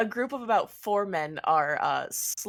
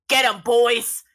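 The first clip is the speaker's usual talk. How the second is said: loud, raised rallying call; stage singer's command; 5 semitones higher, 9 dB louder, slower than usual. Acted. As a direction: sic the gang on them. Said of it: "the guy on the stage".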